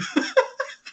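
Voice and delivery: a burst of real laughter, high and breathless; breathy high laugh. Real, not acted. Laughing at the player in the wrong hat. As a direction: crack up at the hat blunder.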